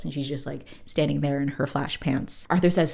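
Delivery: ordinary talk, nothing marked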